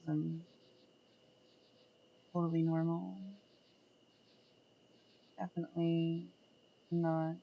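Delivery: low, soft seductive murmur; seductive deep voice. Shown as a demonstration. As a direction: drop into a sultry low voice. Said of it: "drop my voice down here".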